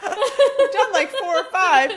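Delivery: high, loud talk through real laughter; laughing. Real, not acted. Joking with co-host about tools already given, laughing. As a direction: protest it while laughing out loud.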